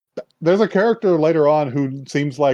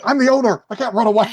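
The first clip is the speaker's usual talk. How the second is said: much higher, louder whiny panic; hammed-up panic; 11 semitones higher, 4 dB louder, faster than usual. Owner tells part-timer he can't flee, high whiny panic. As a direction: squeak it out in mock despair. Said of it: "He's just like".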